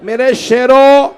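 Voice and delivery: high, loud, slow wailing cry; loud lament. Acted. Zainab calls to her slain sons, loud slow lament. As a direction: wail it out over the two bodies, stretched and grieving. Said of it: "बुलंद आवाज से कहा".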